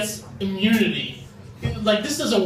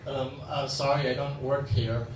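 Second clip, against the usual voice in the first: low, quiet, sheepish mumble; awkward mumble; 8 semitones lower, 6 dB quieter than usual. Retold or imitated. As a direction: mumble it like a clueless teen. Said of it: "I'm like".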